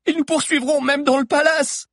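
very high, loud cry; high-pitched fearful yell; panicked shouting. A narrator's reading. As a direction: cry out in alarm at the danger. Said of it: "cria Alix".

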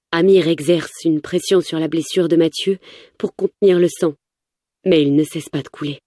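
ordinary delivery

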